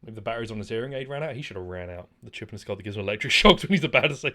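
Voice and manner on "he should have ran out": ordinary talk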